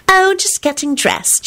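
high, slow, sugary voice; sweet. A narrator's reading. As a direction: answer your lover sweetly, drawing it out. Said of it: "she replied sweetly".

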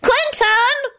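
very high, drawn-out call; fretful call. A narrator's reading. Sludge calling Quentin from the shop front, fretful. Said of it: "called fretfully from the front".

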